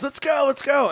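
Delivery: high-pitched urging, imitating a pushy pal; mock egging-on. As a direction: goad your friend onto the stage. Retold egging a friend onstage.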